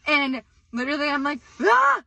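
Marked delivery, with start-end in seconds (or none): 1.6-2.0 short high, loud shriek; re-enacted scream. Retelling panic after the fire is out, re-enacted scream. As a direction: let out a sudden acted scream. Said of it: "And I'm still screaming".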